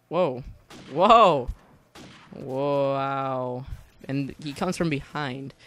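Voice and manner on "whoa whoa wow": sudden loud startled whoa; surprised whoa. Real, not acted. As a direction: jolt of real surprise.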